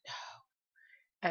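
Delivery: no whispered, unvoiced and soft; low whisper. Shown as a demonstration. Vlogger demonstrating the spirit's low no, whispered. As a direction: breathe the word no out low. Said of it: "really low".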